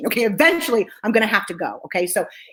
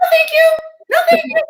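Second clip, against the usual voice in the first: very high, chirpy mock politeness; high-pitched refusal; 5 dB louder than usual. Showing her only answer at first, high sing-out refusal. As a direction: refuse in a high, loud squeak.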